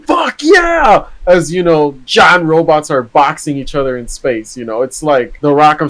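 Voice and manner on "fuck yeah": high, loud shout of triumph; hype scream. Acted. Explaining what the robot anime wants viewers to scream, hype scream. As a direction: scream it like a pumped-up battle cry. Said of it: "it wants you to scream".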